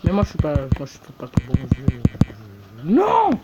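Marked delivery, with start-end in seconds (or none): 2.8-3.3 shout. Real, not acted. Loses game connection.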